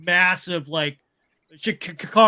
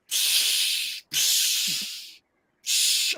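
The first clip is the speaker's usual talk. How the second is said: air hiss; much slower than usual. Retold or imitated. Hissing air in sealed hangar.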